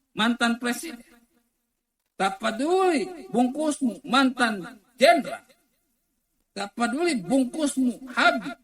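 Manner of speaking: loud, high-pitched rhetorical refrain; full-force preaching. Real, not acted. Preacher dismisses titles and outward wrapping, shouting high. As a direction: hammer each repeat out to the crowd.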